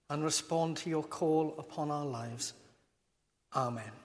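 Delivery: low, soft prayer voice; hushed, measured public prayer; spoken prayer. Real, not acted. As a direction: lead a prayer quietly, letting each phrase fall. Leads the congregation in prayer.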